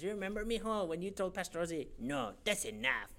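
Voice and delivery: teasing impression of a church sister; Sister Minga. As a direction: imitate her fondly, quick then emphatic. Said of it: "Sister Minga remembers".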